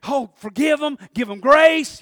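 loud, fervent plea to forgive. Retold or imitated. New man inside urging forgiveness, loud. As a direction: call out for grace with fire. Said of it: "He says".

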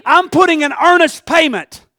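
loud pulpit preaching; rising sermon. Acted. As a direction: lift the crowd with each line. Preaching climb, louder.

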